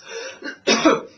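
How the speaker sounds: breath in, then a loud cough; deliberate loud cough from the lungs; demonstrated cough. Shown as a demonstration. Coach showing the breath taken before coughing, deliberate cough. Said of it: "just before you cough".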